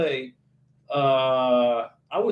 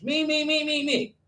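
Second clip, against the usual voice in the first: higher, mocking sing-song me-me-me; mock self-absorbed YouTuber; 7 semitones higher than usual. Mocking vain YouTubers to his viewers, in a higher sing-song parody voice. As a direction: parody a vain vlogger who only talks about himself. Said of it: "Some YouTubers have put a YouTube channel together all about them".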